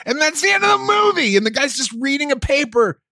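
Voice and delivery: very high, exasperated shouting; high-pitched wail of indignation; outraged rant. Real, not acted. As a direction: rail in comic disbelief, pitch way up. Outraged rant about film's bleak ending.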